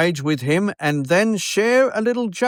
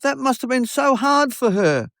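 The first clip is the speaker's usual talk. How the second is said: high, tender pity; lightly voiced sympathetic wife; 10 semitones higher than usual. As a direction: mimic the wife feeling for her idol. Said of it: "And she said".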